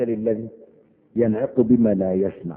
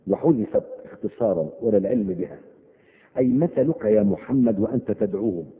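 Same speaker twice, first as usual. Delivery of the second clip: ordinary talk, nothing marked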